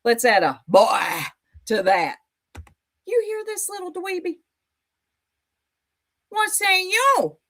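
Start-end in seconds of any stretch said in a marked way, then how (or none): 3.1-7.4 high mocking taunt. Real, not acted. Taunting opponent.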